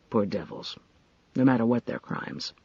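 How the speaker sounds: low, soft and sorrowful; quiet regret; sad. Acted. Grim news of the body.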